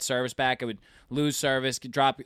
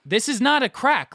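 retold outrage; 9 semitones higher, 3 dB louder than usual. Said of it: "I was freaking out".

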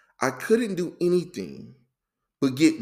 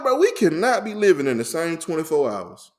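loud, high start tumbling down in disbelief; incredulous protest; 3 semitones higher, 3 dB louder than usual. Retold or imitated. Retelling disbelief at other people's busy days, incredulous. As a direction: protest it like it's impossible.

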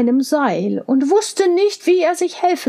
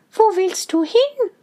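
Mädchenstimme; 8 semitones higher than usual. A narrator's reading. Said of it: "sagte Rosenrot".

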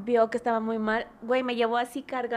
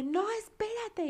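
high, slow soap-opera plea; telenovela plea; 11 semitones higher, 5 dB quieter, slower than usual. Retold or imitated. Girlfriend begging her leaving boyfriend, high telenovela plea. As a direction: beg him to stay like a telenovela heroine. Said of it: "de telenovela yo le dije".

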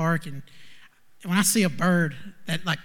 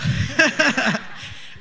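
emotional laugh; 9 semitones higher, 4 dB louder than usual. Real, not acted. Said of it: "I'm getting really emotional".

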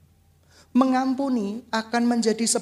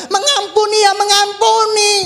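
shouted mengampuni ya mengampuni, much louder and higher; mengampuni keras; 9 semitones higher, 8 dB louder than usual. Shown as a demonstration. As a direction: punch "mengampuni ya mengampuni" out loud. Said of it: "cara mengampuni ya mengampuni". (teriakkan "mengampuni ya mengampuni" keras)

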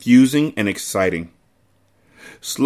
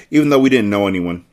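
ordinary delivery, nothing marked

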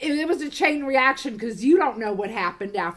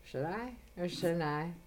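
low, very soft musing; hushed pondering; 5 semitones lower, 12 dB quieter than usual. Retold or imitated. Woman debating a prank over the sheets, quietly pondering. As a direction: weigh it up quietly, as if to yourself. Retold moment of second thought.

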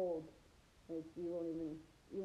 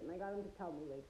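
ordinary delivery

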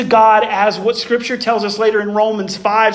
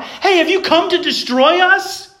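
mock demon cry; 9 semitones higher than usual. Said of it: "demons screaming out".

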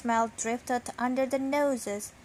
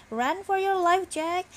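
panicked cry; 6 semitones higher than usual. A narrator's reading. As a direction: yell for your friend to flee. Mouse fleeing the cat.

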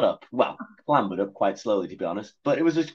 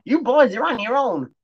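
high, loud frightened cat; panicked cry; 14 semitones higher, 6 dB louder than usual. Acted. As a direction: shriek it in sudden panic. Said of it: "cried the cat".

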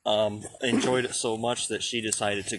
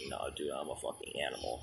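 a soft, quicker mock ogre line; hushed Shrek quote; 9 semitones lower, 12 dB quieter, faster than usual. Friend imitating Shrek, softly and quickly. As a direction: mutter it like a proud beast. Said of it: "and he's like".

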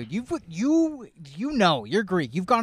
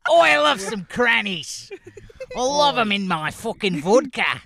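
put-on Australian accent; 5 semitones higher than usual. Comic bragging about crannies in vodka, put-on Australian accent.

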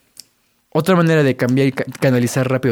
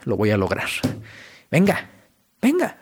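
self pep talk; 3 semitones lower, 3 dB quieter than usual. Retold or imitated. Listener psyching themselves up before a goal: rising pep talk. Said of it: "simplemente dices".

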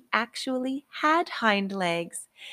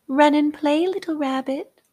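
high, louder fairy voice; tiny tinkling fairy voice; 9 semitones higher, 7 dB louder than usual. Acted. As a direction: call him off to play, fairy-like. Fairy sends rabbit off.

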